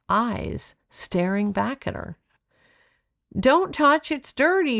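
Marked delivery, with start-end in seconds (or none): 3.4-4.8 high, louder sharp warning; mother's alarmed shout. A narrator's reading. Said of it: "shouted her mother".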